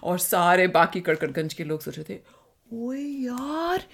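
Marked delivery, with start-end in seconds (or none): 2.7-3.9 excited crowd voice. Acted. Townsfolk gasp at Rita's scolding.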